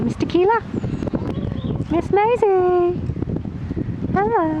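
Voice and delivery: high, slow sing-song greeting; talking to pets. Real, not acted. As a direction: coo at the hens by name. Greeting the chickens by name.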